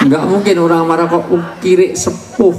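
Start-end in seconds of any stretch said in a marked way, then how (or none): 1.3-2.5 mock Javanese cursing. Imitating an angry Javanese curse.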